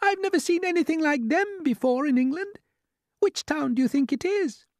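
very high-pitched ladybird voice; wondering ladybird. Acted.

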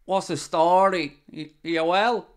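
put-on broad Dublin accent; strong Dublin accent. Showing a viewer what strong Dublin sounds like, broad accent. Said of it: "like, real strong Dublin".

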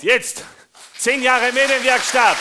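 loud, high, slow proclamation; drawn-out festive announcement; showman's announcement. Acted. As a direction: belt it out like a circus ringmaster. Announcing the big trick.

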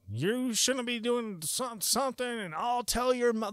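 wag a finger at the teens in mock warning; preachy scolding mom. Mom scolding strangers at the party, mildly preachy. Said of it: "talking cult shit".

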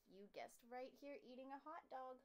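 ordinary delivery, nothing marked

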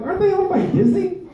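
high, quick incredulous question; squeaky, amused astonishment; mock disbelief. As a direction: shoot the pitch way up, half laughing. Acted disbelief at cursing on TV.

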